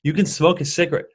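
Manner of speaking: usual speaking voice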